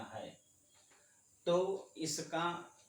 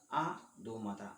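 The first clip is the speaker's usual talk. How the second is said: ordinary talk, nothing marked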